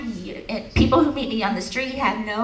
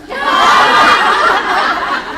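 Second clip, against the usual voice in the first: a loud, high burst of real laughter; big high laugh; 11 dB louder than usual. Real, not acted. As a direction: crack up helplessly at your own story.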